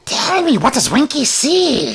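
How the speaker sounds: very high, slow goblin voice; a curious little goblin peering up at a stranger; twitchy goblin. Acted.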